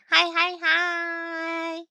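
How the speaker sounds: high, very slow sing-song hellos; cutesy hi hi hi. Acted. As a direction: coo each hi. Cutesy repeated greeting.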